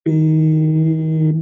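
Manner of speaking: পেন held long and level, loud; drawn-out syllable. Shown as a demonstration. Teacher showing students one unbroken syllable, পেন stretched loud and slow. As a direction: stretch the vowel of পেন as one sustained sound. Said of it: "আমি যখন পেন শব্দটা আমি যখন উচ্চারণ করছি".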